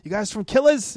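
friendly New England accent imitation. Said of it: "he had the, the New England accent".

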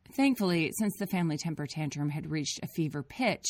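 ordinary delivery

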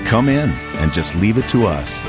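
usual speaking voice

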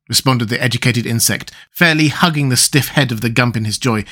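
ordinary delivery, nothing marked

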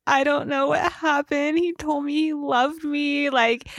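high, hammed-up weepy retelling; mock tearful Joey. Joey fakes tears to gossip Abby, mock weepy high voice. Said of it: "like, kinda crying".

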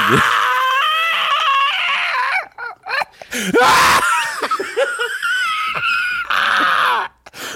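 long, high, loud squealing laugh; uncontrollable laughing fit. Real, not acted. Co-host losing it at own joke, uncontrollable laughing fit. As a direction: laugh helplessly until you nearly pass out. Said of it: "I did some weird laugh thing".

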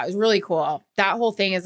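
usual speaking voice

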